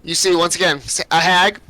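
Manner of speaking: ordinary talk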